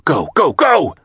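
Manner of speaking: urgent shouted command; shouting. A narrator's reading. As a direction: yell it at the team. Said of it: "the captain shouted".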